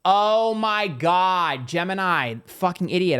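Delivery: drawn-out, high and loud oh my God; real burst of self-exasperation as it clicks; excited realization. Real, not acted. Reader scolding herself as the cards click, loud and excited. Suddenly gets the card meaning.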